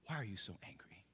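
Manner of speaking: put-on hushed, soft little boy's voice; child's quiet question. A five-year-old son asking his father, softly. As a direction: whisper it like a small child. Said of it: "my five-year-old son looked at me".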